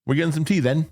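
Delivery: a wife's Scottish accent, lightly mimicked; mock Scottish. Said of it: "the last word of her sentence".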